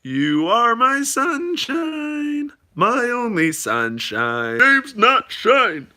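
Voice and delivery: slow, drawn-out sing-song heckle; slow mock serenade; heckler's joke. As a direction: stretch the joke out like an old heckler. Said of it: "he said".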